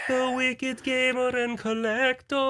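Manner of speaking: slow, high, drawn-out announcement; announcer voice. Acted. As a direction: announce him like a ring announcer. Announcing friend's arrival.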